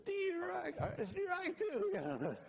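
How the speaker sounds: a tiny sidekick keeping score; mock squeaky aide voice; little guy's voice. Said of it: "the little guy behind him going".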